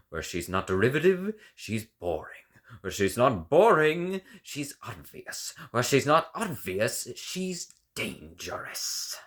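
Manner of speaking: each "not" clause pitched high and loud, each verdict dropped; scathing rhythm. Acted. Critic mocking a rival composer, rhythmic scathing build. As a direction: build the insult in three steps.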